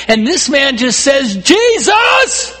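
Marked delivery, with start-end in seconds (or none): 1.4-2.6 very high, loud cry; shouting blind beggar. Retold or imitated. Blind man hailing Jesus as he passes, shouting. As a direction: call out desperately over the crowd. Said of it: "shouting at the top of his lungs".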